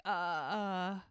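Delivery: drawn-out, halting uhs; stunned hesitation. Retold or imitated. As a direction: stall for time, lost for an answer. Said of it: "He's waiting for an".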